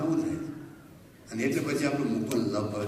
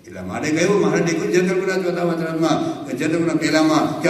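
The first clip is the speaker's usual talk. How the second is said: raised, higher, louder preaching at full force; fervent preaching; 5 semitones higher, 8 dB louder than usual. Acted. Preacher to the assembled devotees, fervent loud preaching. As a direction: preach it out to the whole hall.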